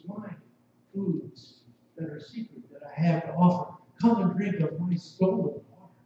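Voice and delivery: usual speaking voice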